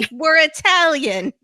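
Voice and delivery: high, loud, drawn-out Italian-American boast; mock Italian-American buddy, slow and loud. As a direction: ham up the Italian-American accent. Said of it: "with this guy's accent".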